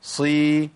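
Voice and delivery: صي held level with an emphatic ص; heavy sad. Shown as a demonstration. Teacher showing tongue adhesion, heavy emphatic sad. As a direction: keep the jaws close, ص heavy in صي. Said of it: "بحقق الإطباق".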